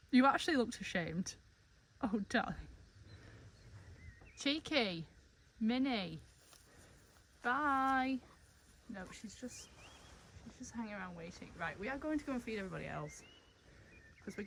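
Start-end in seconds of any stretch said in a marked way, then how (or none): none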